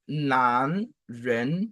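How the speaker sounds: "nan" and "vren" each gliding upward; two rising syllables, "nan, vren"; second tone. Shown as a demonstration. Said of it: "c'est le deuxième ton".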